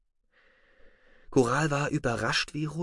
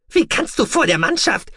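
high, louder outraged protest; hurt pride in every word; indignant; 6 dB louder than usual. Acted. Deputy protesting his commander's rebuke in front of the crew, indignant. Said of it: "rief Zollmon empört".

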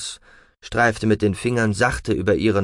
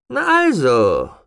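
satisfied; 6 semitones higher, 5 dB louder, slower than usual. Acted. Father satisfied after submission.

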